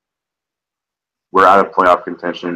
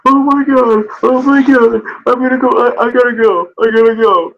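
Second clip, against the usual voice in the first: frantic mock panic. Mocking his co-host's frantic reaction to a firing. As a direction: imitate a panicking fan, pitched way up. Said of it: "And you're like".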